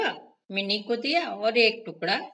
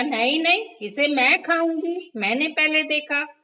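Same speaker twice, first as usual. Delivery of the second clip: quarrelsome mouse; 5 semitones higher, 4 dB louder than usual. A narrator's reading. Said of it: "mini ने कहा".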